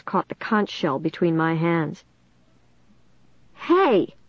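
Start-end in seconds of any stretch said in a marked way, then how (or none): 3.5-4.1 sharp angry "Hey!"; angry cry. A narrator's reading. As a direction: snap it out in anger. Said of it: "Caitlin cried angrily".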